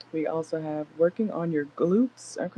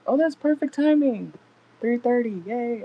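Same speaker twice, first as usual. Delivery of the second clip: pleasantly surprised; 7 semitones higher, 5 dB louder than usual. Real, not acted. Notices the time, delighted.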